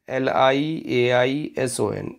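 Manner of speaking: ordinary delivery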